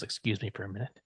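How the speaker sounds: subdued, stunned politeness; quiet polite exit. Acted. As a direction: excuse yourself under your breath. Professor quietly excusing himself.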